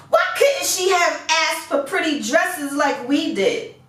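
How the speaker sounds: high, complaining sisters' whine; whiny jealous sisters. A narrator's reading. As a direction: sulk and blame Beauty.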